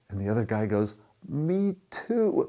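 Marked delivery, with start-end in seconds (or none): 1.3-2.5 very high, thrilled exclamation; hammed-up joy, voice leaping up; amazed delight. Rescuer to a fellow Baptist on the edge, amazed delight.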